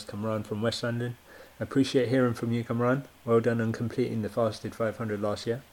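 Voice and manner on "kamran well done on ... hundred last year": ordinary talk, nothing marked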